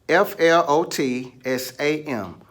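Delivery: F L O T S A M spelled letter by letter, slowly. Shown as a demonstration. Teacher spelling flotsam out slowly, letter by letter, for the class. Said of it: "You don't know how to spell it?".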